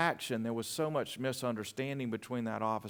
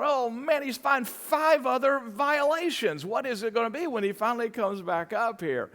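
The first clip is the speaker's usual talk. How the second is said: much higher, louder, anxious fretting; worried inner voice; 12 semitones higher, 7 dB louder than usual. Retold or imitated. Driver waiting on officer, high and louder with mock dread. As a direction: spiral into worry about what he found.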